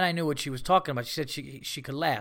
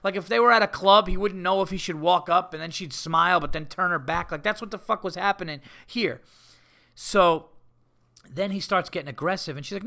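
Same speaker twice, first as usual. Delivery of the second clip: ordinary talk, nothing marked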